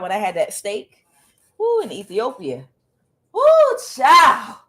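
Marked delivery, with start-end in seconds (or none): 1.6-4.6 high, loud delighted whoop. Real, not acted. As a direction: whoop with relish at the memory. Savouring remembered steak.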